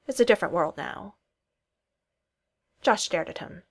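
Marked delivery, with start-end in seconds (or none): none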